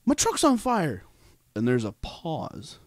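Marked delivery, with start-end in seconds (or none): 0.0-1.0 panicked call. Retold or imitated. Son telling dad his truck burns, mock panic. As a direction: blurt it out to your father.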